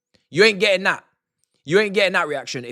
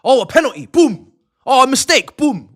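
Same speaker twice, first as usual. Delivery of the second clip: mock jump scare; 6 semitones higher, 9 dB louder than usual. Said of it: "It's like".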